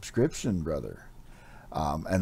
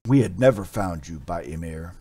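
urgent warrior; 5 semitones higher, 9 dB louder than usual. Acted. Warrior scolds Amra urgently.

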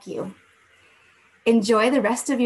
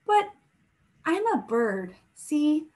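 gentle storybook read-aloud voice; story narration; 2 semitones higher than usual. A narrator's reading. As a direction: read it like a bedtime story for the class. Teacher reads picture book aloud.

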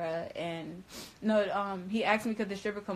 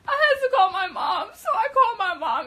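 much higher, loud laughing words; 10 dB louder than usual. Real, not acted. Telling viewers she ran to mom, laughing. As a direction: laugh through the line at herself.

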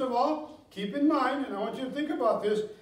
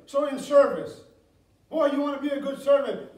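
preaching; 2 semitones higher, 5 dB louder than usual. Acted. Pastor urging the congregation, growing preaching fervor. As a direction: build the sermon's force.

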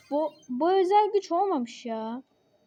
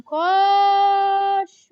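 high, loud shout of koş; loud yell; 6 semitones higher, 10 dB louder than usual. Real, not acted. Gamer screaming at his brawler to run, loud yell. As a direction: bark the order to run.

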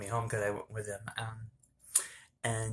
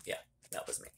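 whisper; 10 dB quieter, faster than usual. Real, not acted. Admits being the drunk one.

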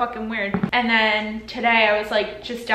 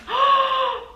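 sharp, high gasp; real gasp of disbelief; shocked gasp; 14 semitones higher than usual. Real, not acted. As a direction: gasp at a nasty surprise. Sees wrong items in package.

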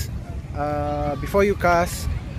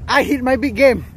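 excited; 5 semitones higher, 7 dB louder than usual. Real, not acted. Repeats catch news.

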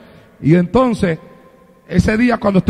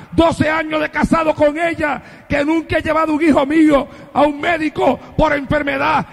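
crying out to God; 5 semitones higher than usual. Retold or imitated.

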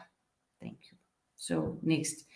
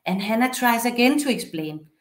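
normal talking voice

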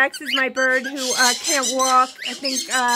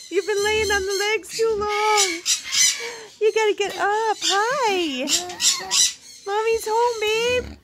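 pet-talk cooing; 5 semitones higher than usual. Real, not acted. Mommy's home to her sweet angel, cooing. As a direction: coo it high to a beloved pet.

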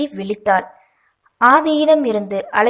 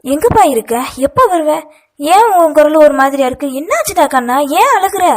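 higher, louder, alarmed questioning; frantic mother on the phone; panicked; 5 semitones higher, 6 dB louder than usual. A narrator's reading. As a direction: fire anxious questions in rising panic. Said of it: "பதறிக்கொண்டு மாறி மாறி கேட்க".

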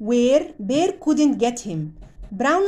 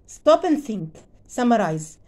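ordinary delivery, nothing marked

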